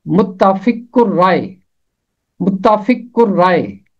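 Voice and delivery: متفق الرائے as mutafiq urrae, the r doubled; pronunciation demo. Shown as a demonstration. Teacher models the hard word متفق الرائے. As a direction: say متفق الرائے with the lam silent. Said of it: "اور اس کا تلفظ ہے".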